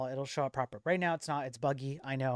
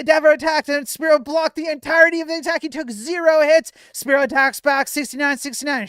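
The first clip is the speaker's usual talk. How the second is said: excited play-by-play, building up louder; sports commentator; 7 semitones higher, 6 dB louder than usual. Acted. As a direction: call the fight like a ringside announcer. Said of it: "get my commentator voice on".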